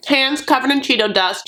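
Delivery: ordinary talk, nothing marked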